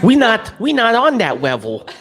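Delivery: put-on high, laughing baby voice; high baby talk with a giggle. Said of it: "my baby voice".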